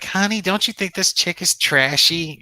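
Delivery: a hint of an excited wife; wife's voice. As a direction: lightly imitate his wife leaning in. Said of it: "She's like".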